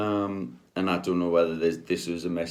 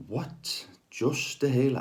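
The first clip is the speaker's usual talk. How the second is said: shocked; 8 semitones higher, slower than usual. Retold or imitated. Shocked reaction to "just a healer.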